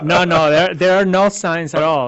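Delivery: loud laughing denial. Real, not acted. Laughing denial of gang signs.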